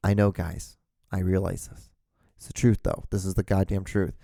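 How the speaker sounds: ordinary delivery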